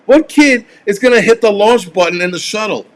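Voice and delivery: dismissive dad scoffing at the TV; imitating dad scoffing. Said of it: "he's like".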